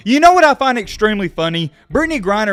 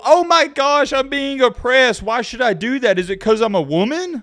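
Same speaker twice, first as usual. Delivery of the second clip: mocking girlfriend; 7 semitones higher than usual. Boyfriend mocks a girlfriend refusing chores, high whine. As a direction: parody her offended whine. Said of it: "They go".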